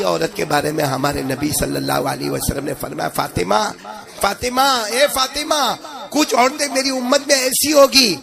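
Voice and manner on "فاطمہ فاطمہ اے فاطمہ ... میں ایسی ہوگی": rising, urgent call of a name; calling out to Fatima. Acted. Prophet calling his daughter, urgent rising call.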